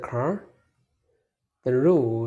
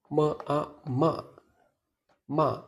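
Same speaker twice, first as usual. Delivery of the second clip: "ma" short, dropping sharply; fourth tone; 2 semitones higher than usual. Shown as a demonstration. Teacher shows learners the fourth tone, "ma" falling fast. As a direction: drop each "ma" quickly and firmly. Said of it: "The fourth tone is short and powerful".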